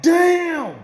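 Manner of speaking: loud, high, drawn-out astonishment; amazed reaction. Retold or imitated. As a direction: exclaim it in awe at what happened. His stunned reaction to the beating.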